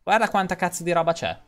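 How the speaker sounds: ordinary delivery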